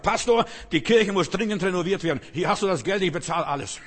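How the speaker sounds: loud, high, urgent outburst; startled shout. Retold or imitated. Rich man hit by plaster shouts at the pastor. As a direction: blurt it out as if struck on the head.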